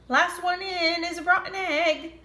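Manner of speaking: high chirpy hen voice; chicken voice. Acted. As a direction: play a cheeky hen.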